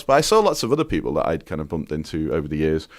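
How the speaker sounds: ordinary talk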